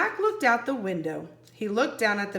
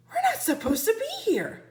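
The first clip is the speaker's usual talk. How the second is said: higher-pitched nervous warning; worried boy; 13 semitones higher than usual. Acted. Jack warning Annie, higher and anxious. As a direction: fret like a cautious kid.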